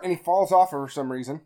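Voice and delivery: ordinary delivery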